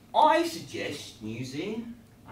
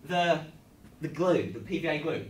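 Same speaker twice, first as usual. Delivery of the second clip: ordinary talk, nothing marked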